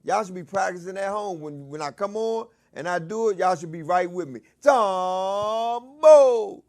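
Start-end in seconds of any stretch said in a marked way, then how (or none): 4.6-6.6 high, loud chant of the name; crowd response shout. Shown as a demonstration. Host demonstrating the crowd's answer, loud and high. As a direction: belt it back as the crowd. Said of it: "Call and response?".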